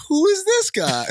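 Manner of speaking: high-pitched, laughing bafflement; baffled. Retold or imitated. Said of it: "And I'm like".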